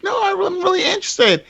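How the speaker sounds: high-pitched quoted protest; imitated insistent fiancee. Fiancee defending her tofu order, imitated insistence.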